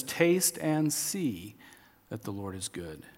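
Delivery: slow quote. Acted. Quoting psalm slowly.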